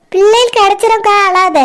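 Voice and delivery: normal talking voice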